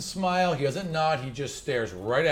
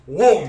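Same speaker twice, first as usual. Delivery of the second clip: loud cartoonish "whoom"; punchy spoken sound effect; imitated whoosh of falling; 3 semitones higher, 10 dB louder than usual. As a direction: voice a big comic sweep as someone topples. Imitates falling over in narration.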